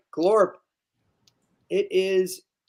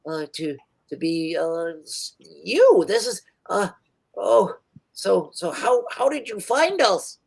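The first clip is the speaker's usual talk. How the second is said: flustered gnome; 2 semitones higher than usual. Acted. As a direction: caught off guard and stammering. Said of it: "he's like".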